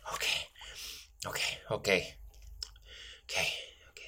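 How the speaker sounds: breathless whispered reassurance; whispering. Acted. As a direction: whisper to steady yourself. Steadying himself after tripping.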